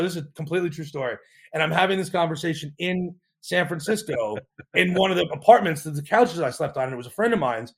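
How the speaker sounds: usual speaking voice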